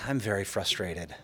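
calmly telling the management office he is frustrated. Retold or imitated. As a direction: say it evenly, deliberately calm. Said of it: "very calmly said".

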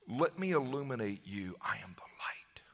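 soft whisper; whispered Jesus. Acted. Jesus offering light to the lost, whispered. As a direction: whisper it like an intimate promise.

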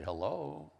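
"Hello" sung out in three gliding tones; imitated father-in-law's three-tone phone "Hello". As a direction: glide "Hello" through three notes. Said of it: "fell into saying it that way".

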